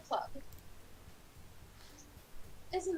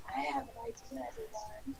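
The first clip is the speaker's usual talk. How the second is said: deeper put-on character voice; odd low voice; 6 semitones lower, 5 dB quieter than usual. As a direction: try an oddly low, strange take. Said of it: "just go with the voice you were going with".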